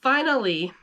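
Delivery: ordinary delivery, nothing marked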